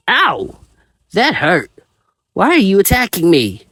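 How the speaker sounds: high, pained little creature; hurt creature voice. A narrator's reading. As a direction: yelp like a hurt small monster. Veemon hit by attack.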